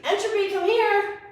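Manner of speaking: calling out to dog. Real, not acted.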